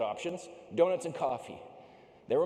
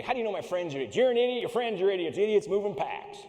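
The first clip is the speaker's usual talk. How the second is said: louder, higher, indignant pushback; 5 semitones higher, 6 dB louder than usual. Retold or imitated. Climber snapping back at the Texan oilman, indignant. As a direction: argue back, stung. I'm getting angry.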